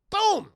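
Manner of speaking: very loud, high "boom"; imitated impact, one big burst; explosive boom. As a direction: shout the sound of a crash. Imitates crash landing.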